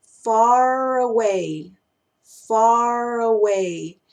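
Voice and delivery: "far away" very slow, run together like one word with a stretched R; R held from "far" into "away"; slow linked phrase. Shown as a demonstration. Teacher to students, slowly modelling the linked R. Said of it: "suena como si fuera una sola palabra".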